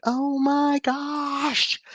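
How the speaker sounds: high, slow mock gasp of alarm; retold panic. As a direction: stretch out the retold oh my gosh. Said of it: "I'm like".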